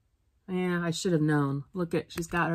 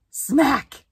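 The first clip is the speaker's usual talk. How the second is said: mock smack; 8 semitones higher, 5 dB louder than usual. Swatter hit in story.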